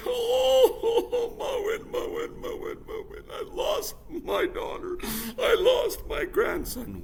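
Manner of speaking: high, loud wailing lament; crying, wailing. Acted. Grandmother mourning daughter and grandson, loud wail. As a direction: wail for the lost. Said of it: "all that she would do was cry".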